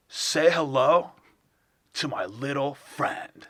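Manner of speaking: slow, halting bad actor impression; Christopher Walken. Doing a friend's failed version of a famous actor, slow halting impression. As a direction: stretch the words out with odd pauses. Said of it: "version of Christopher Walken".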